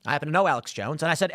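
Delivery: ordinary talk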